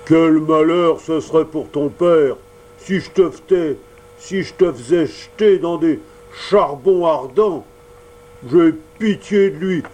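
bearded showman blustering about the fire; gruff Mangefeu. Acted. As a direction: threaten then soften, booming.

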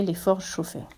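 usual speaking voice

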